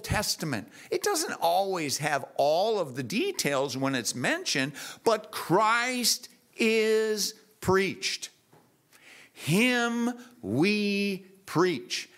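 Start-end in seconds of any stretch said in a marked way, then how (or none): 5.0-12.1 very slow, each word weighed out; slow right down and preach it; slow emphatic preaching. Acted. Preacher drives home the point.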